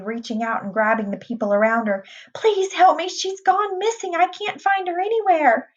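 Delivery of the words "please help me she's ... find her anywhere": very high, panicked plea; frantic. A narrator's reading. Mother begging crowd for help, frantic. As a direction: beg for help in a high, desperate cry. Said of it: "Missus Brahms cried frantically".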